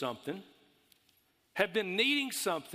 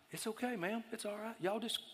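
softer, higher reassuring voice; calm the flustered cashier gently; soothing the cashier; 6 semitones higher, 7 dB quieter than usual. Retold or imitated. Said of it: "And I'm like".

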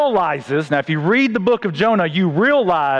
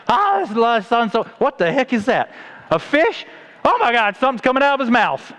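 mildly shocked onlooker, pitched higher; startled beachgoer; 4 semitones higher than usual. Acted. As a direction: act out a bystander spotting something bizarre. Acting startled beachgoer seeing Jonah vomited.

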